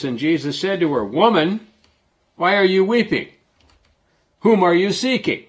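lifted preacher's reading tone; scripture reading. Acted. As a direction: read the verses out to the congregation. Reads scripture aloud.

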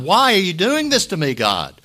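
loud, high anguished plea to God; crying out. Retold or imitated. Job crying out to God in his trial, loud and anguished. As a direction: hurl the question at heaven. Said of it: "Job's just crying out to God".

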